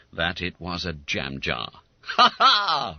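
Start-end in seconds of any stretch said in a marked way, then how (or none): 2.1-3.0 loud hearty laugh; roaring laugh. A narrator's reading. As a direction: roar with laughter. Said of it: "roared Bob Cherry".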